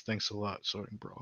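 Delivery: small, very soft mumble; deflated, barely audible thanks; shrinking into himself. Acted. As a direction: mutter it, withdrawn and embarrassed. Character shrinks into himself, mutters.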